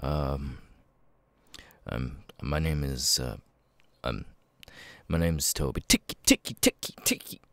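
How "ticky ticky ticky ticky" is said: breathy ticky-ticky spill; vocal tic. Acted. Toby tics right after saying his name, breathy ticking. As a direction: let "ticky" tumble out involuntarily. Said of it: "I have Tourette's".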